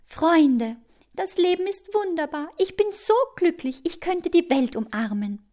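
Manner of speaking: high, elated cry to friends; overjoyed, high-pitched ant; joyful calling out. A narrator's reading. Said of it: "holt sie tief Luft und ruft".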